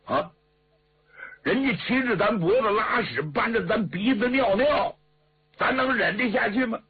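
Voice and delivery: high-pitched, heated retort; indignant hot-headed officer. Acted. Officer scorning the adviser's patience, indignant and high-pitched. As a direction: argue back in outrage, pitch climbing to the end.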